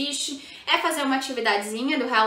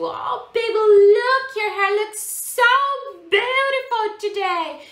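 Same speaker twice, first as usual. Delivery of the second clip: put-on high, gushing, slow baby talk; super excited; 9 semitones higher, 7 dB louder, much slower than usual. Shown as a demonstration. Mother to her small child while combing hair, gushing excitedly. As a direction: gush over the child's hair with big excitement. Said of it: "mas tem que ser muito legal".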